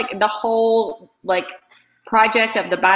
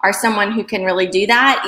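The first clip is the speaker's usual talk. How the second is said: ordinary talk, nothing marked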